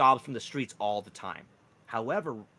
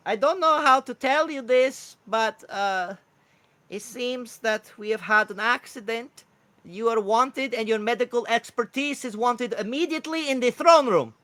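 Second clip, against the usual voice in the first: high, loud bird voice, drawn out; urgent kookaburra; 11 semitones higher, 8 dB louder than usual. Acted. Messenger reporting an accident to the cleric, urgent high kookaburra. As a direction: deliver grave news in a high, loud squawk.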